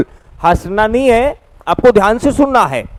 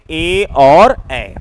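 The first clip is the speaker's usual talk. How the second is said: ए और ए stretched long; very slow vowels; 4 semitones lower, much slower than usual. Shown as a demonstration. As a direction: draw out ए और ए syllable by syllable. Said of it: "ये दोनों स्वर आपके कौन से". (ए और ए बहुत धीरे खींचकर)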